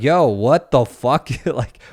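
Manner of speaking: high, loud, frantic complaint with a laugh; mock-panicked phone complaint; retold frantic yell to the Taliban; exasperated yell. Dad calls Taliban, exasperated.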